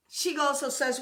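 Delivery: ordinary talk, nothing marked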